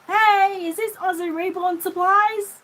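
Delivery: high, loud and slow, calling through the door; hammed-up visitor at the door; customer voice. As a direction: play the stranger shouting her question.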